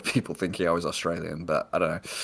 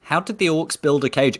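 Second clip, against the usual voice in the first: higher-pitched mock questioning; mimicking co-host's question; 8 semitones higher than usual. Reliving a co-host's question about orcs, lightly mimicked. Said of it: "flashback of you going".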